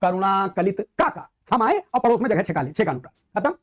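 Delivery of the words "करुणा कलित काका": verse line spaced out word by word; unhurried teaching recital; slow recitation. Shown as a demonstration. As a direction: recite slowly to show the repeated sounds. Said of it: "तो जैसे single रिपीटेंस मारी".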